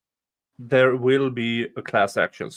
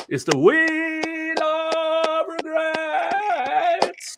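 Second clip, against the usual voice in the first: drawn-out announcer voice, much higher; game show announcer; much slower than usual. Pretend. Announcing his game-show wheel.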